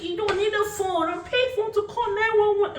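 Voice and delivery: high, insistent mother voice; mom explaining. Retold or imitated. Mom correcting her daughter about pay phones, high and insistent. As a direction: set them straight like a know-it-all mom. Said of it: "She's like".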